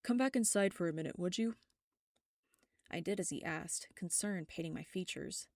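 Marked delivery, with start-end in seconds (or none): 0.0-1.6 fast, louder urgent request from a hurting man; urgent plea. Acted. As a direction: hurry her back inside, he's in pain. Michael urgent, blinded by sun.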